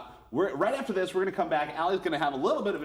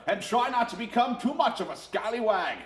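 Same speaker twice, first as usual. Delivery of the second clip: higher hammed-up pirate with laughs; 8 semitones higher than usual. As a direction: play a cheesy pirate captain. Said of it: "we're gonna just talk normal".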